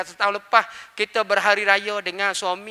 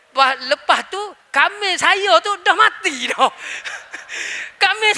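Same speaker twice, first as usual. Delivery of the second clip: laughing; 9 semitones higher than usual. Real, not acted. The preacher laughs at his own goat joke, high and giggling. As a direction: laugh through the punchline. Punchline of a funny story.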